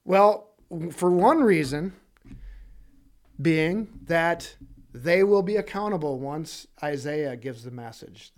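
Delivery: normal talking voice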